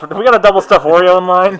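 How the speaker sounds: joking radio announcement; mock radio call. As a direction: call it in like ride staff, for laughs. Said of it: "I'd like to hear that radio call".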